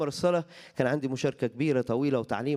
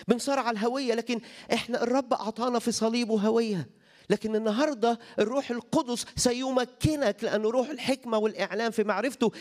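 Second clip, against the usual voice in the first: full-force preaching; 7 semitones higher than usual. Acted. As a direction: build to a climax on the Spirit.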